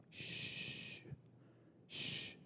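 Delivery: unvoiced shh to silence him; shushing. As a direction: hiss a sharp shh at the beggar. Religious people shushing.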